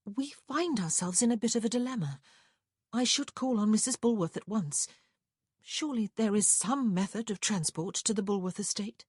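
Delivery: high, well-mannered lady's voice; sweet, polite lady. Acted. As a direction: appeal sweetly to the clerk for help. Narrator voices Prudence speaking sweetly.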